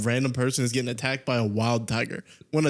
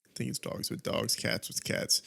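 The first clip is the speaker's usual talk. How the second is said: whispering; 8 dB quieter than usual. Real, not acted. Quiet unvoiced aside.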